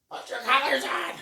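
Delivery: high, loud bossy yell; bossy gym lady. Retold or imitated. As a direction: bark it like a strict gym warden. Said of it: "collar Nazi".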